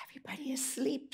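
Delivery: quick hushed whisper; fast whisper. A narrator's reading. Narrator whispering that the animals sleep. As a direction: rush it out under your breath.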